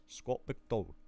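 low, soft, lightly goofy name voice. Acted. Streamer mocking how they say the writer's name, lightly goofy voice. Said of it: "saying his name in a fucking goofy voice".

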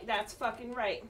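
ordinary talk, nothing marked

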